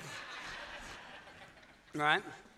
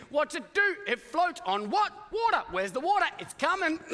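family questioning; 6 semitones higher than usual. Acted. Noah's family quizzing him.

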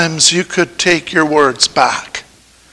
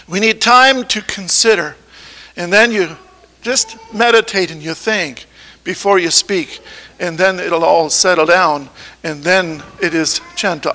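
calm and gentle; 2 semitones lower, 7 dB quieter than usual. Shown as a demonstration. Showing how calm speech sounds.

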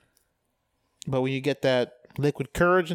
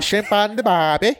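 high, hammed-up Australian twang; mock Aussie, pitched up; Australian accent; 5 semitones higher, 4 dB louder than usual. Shown as a demonstration. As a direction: put on a silly Australian accent. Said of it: "We both gave you ours".

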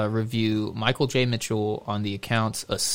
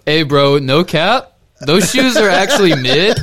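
loud, higher slang bro; trying too hard cool; 6 semitones higher, 11 dB louder than usual. Pretend. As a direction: overdo the cool-kid slang, laughing. Hamming up youth slang.

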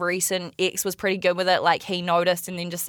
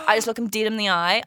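ordinary delivery

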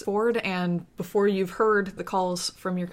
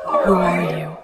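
overlapping whispers; 10 dB louder, slower than usual. A narrator's reading. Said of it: "they all whisper at once".

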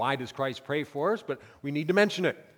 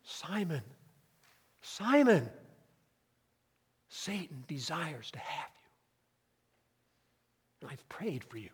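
loving tone. Shown as a demonstration. Preacher showing Christ's loving voice to Simon. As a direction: hush it gently, full of concern. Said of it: "a tone of voice of love".

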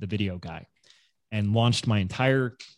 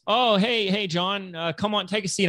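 friendly barber greeting; 7 semitones higher than usual. Retold or imitated. Acting barber greeting next client.

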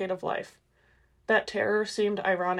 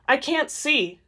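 higher, frustrated outburst; exploding in frustration; 6 semitones higher, 4 dB louder, slower than usual. A narrator's reading. Alice cutting off Edward's questions, exasperated outburst. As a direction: burst out at them, pitch jumping up. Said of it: "she exploded back at him".

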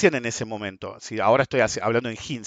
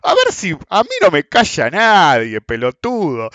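loud, higher, slowed mock defiance; angry retort; 6 semitones higher, 5 dB louder, slower than usual. The rude man refusing to be silenced, mock shouted. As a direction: bark it like a bully who won't be hushed. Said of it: "the man keeps yelling".

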